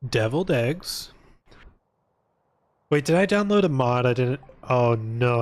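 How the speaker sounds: usual speaking voice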